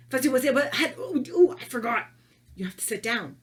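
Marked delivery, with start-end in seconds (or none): 0.1-2.1 messing up. Pretend. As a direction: pretend fumble, loud rising oohs. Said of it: "Now, if you mess up".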